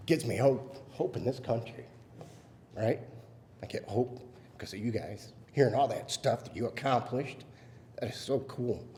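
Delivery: holding back tears as you thank them; emotional. Real, not acted. Parent thanking the audience for honoring daughter, emotional. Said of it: "I'm sorry, I get a little emotional".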